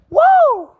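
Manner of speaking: high, loud "woo"; exultant whoop. Real, not acted. Preacher whooping after "awakens unto redemption", exultant whoop. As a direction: let out a piercing preacher's whoop.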